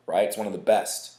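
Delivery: ordinary talk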